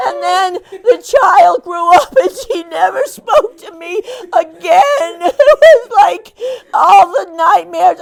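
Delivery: loud, high, laughing half-sob; mock wailing through laughter. Volunteer hamming up her grief for the mom, loud laugh-crying. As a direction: wail the tragedy while losing it to laughter.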